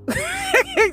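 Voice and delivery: short high giggle. Real, not acted. Laughing as she says women can be raunchy too. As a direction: giggle at your own boldness. Laughing at her own point.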